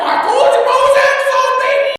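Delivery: high-pitched, excited retort; hyped-up I-told-you-so; triumphant crowing. Retold or imitated. Crowing to grandfather about fast delivery.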